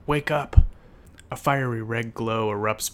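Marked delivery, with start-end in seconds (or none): 0.1-0.6 loud, high, urgent shout; raised voice, desperate and forceful. A narrator's reading. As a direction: shout to rouse a sleeper. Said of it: "he shouts in desperation".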